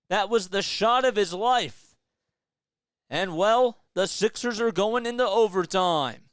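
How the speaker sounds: building from calm call to loud, high, fast excitement; live play-by-play. Acted. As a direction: call the final seconds like a broadcaster.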